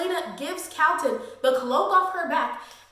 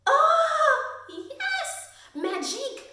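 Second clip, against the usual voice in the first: a much higher "Oh" that melts into a laugh; gleeful squeal; 13 semitones higher, much slower than usual. Real, not acted. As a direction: squeal with fangirl joy. Fangirling over magic reveal.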